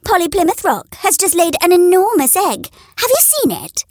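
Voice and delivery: high teasing hen voice; high jeering hen; mocking hen. A narrator's reading. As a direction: needle her with a sly hen voice. Neighbour hen mocking Henrietta.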